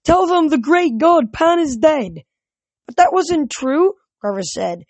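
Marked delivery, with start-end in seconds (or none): 0.0-2.2 high, slow proclamation; crying out. A narrator's reading. Voice from the shore to a passing sailor, crying out. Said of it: "heard a voice crying from the shore". 2.9-3.9 high-voiced young follower's objection; protesting satyr. A narrator's reading. Satyr denying that his god is dead, protesting. Grover protesting the news of death.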